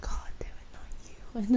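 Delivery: imitating a substitute's whispered groan. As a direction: breathe it out like an annoyed teacher. Substitute groans at her arrival.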